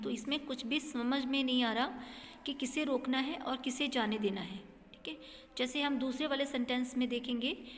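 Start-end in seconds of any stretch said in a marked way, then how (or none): none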